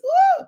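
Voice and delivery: high shouted woo; praise whoop. Real, not acted. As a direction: let out a joyful whoop. Preacher whooping.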